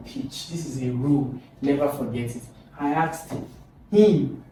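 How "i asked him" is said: "him" set apart after a pause and said louder; loud stressed "him"; emphasis on HIM. Shown as a demonstration. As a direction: pause after "asked", then punch "him". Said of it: "I'm emphasizing him".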